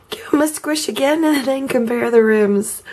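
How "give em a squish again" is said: ordinary delivery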